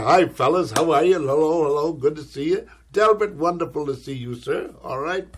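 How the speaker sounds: loud, booming hello to the guests; hearty greeting. Retold or imitated. Greeting the producers with loud, hammy cheer. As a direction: greet them big and jovial.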